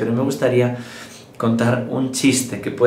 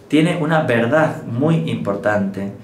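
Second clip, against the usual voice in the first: ordinary talk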